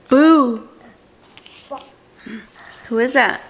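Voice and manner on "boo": playful spooky boo, a bit louder; hammed-up ghost shout; mock yell. A narrator's reading. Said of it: "than just yelling".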